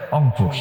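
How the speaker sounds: অংপুর with the r dropped; Rangpur pronunciation. Shown as a demonstration. Preacher joking that Rangpur people drop the r, অংপুর. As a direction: say অংপুর, no r at the start. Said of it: "রংপুরে র নাই".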